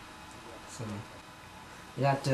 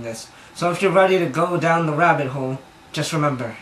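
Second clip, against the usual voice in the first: hyped-up warning; 3 semitones higher, 7 dB louder than usual. Acted. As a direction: belt it out like a wacky disclaimer. Reads out the wacky warning.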